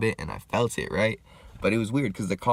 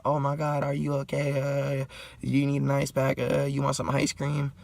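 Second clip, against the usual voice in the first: put-on worried old lady; 7 semitones higher than usual. Lady checks on the man she hit, high mock worry. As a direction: imitate a fretting old lady, pitched up high.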